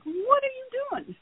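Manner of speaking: high-pitched mock disbelief at the pet; mock scolding question. Owner to bird throwing peanuts, high mock disbelief. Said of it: "and I'm like".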